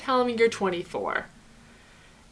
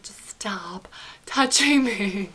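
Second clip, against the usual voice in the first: slow breathy whisper under her breath; barely voiced, hushed plea to stop; much slower than usual. Quietly wishing he'd stop.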